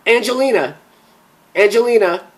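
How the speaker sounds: high, loud calling down the phone. Acted. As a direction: call her name to reach her. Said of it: "he called out".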